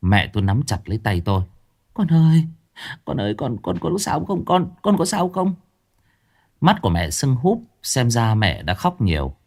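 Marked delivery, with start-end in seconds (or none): 1.9-5.5 worried mother. A narrator's reading. Mother at injured son's bedside.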